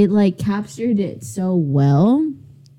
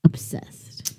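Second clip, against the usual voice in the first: whisper; 7 dB quieter than usual. Real, not acted. Whispered word.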